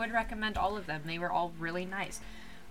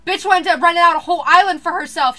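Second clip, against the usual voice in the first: loud; 8 semitones higher, 18 dB louder than usual. Retold or imitated. Retelling annoying guest, loud.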